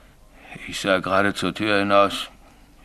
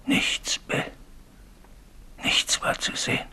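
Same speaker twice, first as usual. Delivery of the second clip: hushed, almost voiceless telling; 8 dB quieter than usual. Acted. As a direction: drop to a soft, haunted near-whisper. Signalman tells of empty tunnel. (leise und tonlos)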